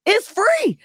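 high-pitched insistent squeal; emphatic plug. Real, not acted. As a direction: push it high to sell the point. Repeats the hyped plug.

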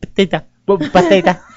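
put-on Cuban-accented Bethesda. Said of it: "it's your Cuban accent".

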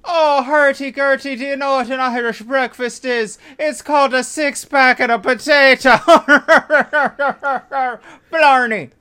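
high, loud cartoon Irish accent; mock Irish accent. Leprechaun in the movie talking to Italians, cartoon Irish accent. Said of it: "an American's version of an Irish accent".

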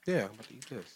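talking with dentures removed. Real, not acted. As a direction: loose gummy mouth, no teeth. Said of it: "pulling your dentures out".

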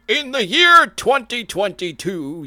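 high, loud and slow, like a film trailer announcer; movie trailer voice. Pretend. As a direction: announce it like an epic sci-fi trailer. Mock movie trailer opening.

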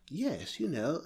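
softly spoken mock royal accent; Queen's English. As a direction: imitate the Queen's English, quietly. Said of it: "who spoke like the Queen".

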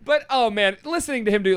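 normal talking voice

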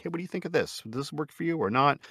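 high, soft retold question; tentative asking. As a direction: ask lightly up high, seeking approval. Acts out asking author for approval.

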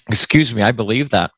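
ordinary delivery

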